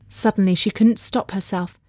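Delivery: plain reading voice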